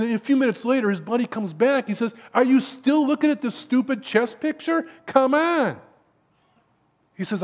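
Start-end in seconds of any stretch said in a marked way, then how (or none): 2.3-5.8 high-pitched, incredulous exasperation; loud, fed-up last push; exasperated friend. Acted. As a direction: scold a buddy in acted disbelief.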